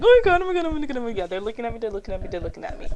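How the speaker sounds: high, loud, rushed freak-out; mock panic. Imagining her panic at the stares, loud and high. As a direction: spiral into panic. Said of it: "I'd just be freaking out".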